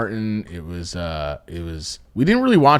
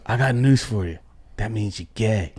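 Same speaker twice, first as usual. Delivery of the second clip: bad acting; 6 dB quieter than usual. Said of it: "he delivers it like a really off".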